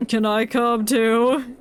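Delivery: ordinary delivery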